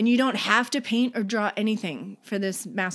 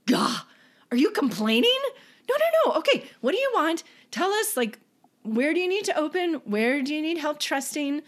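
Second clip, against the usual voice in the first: much higher, animated and teasing; playful exclamation; 7 semitones higher than usual. Retold or imitated. Imagined future people teasing a complainer, high and animated. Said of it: "complaining could be like".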